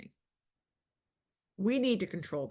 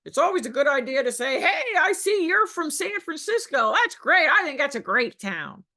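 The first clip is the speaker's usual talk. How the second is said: mock cheesy pitch; 6 semitones higher, 7 dB louder than usual. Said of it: "He said".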